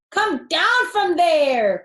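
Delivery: high, loud and slow command; cry out. A narrator's reading. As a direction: shout up at the kids, slowly. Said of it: "cried Fox".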